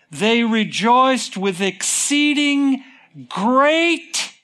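high, slow, emphatic proclamation; loud rejoicing. Acted. Preacher reading how the wise men rejoiced, loudly exultant. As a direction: ring out exceeding joy, stretching the big words. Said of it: "They weren't quiet about it".

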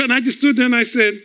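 ordinary delivery

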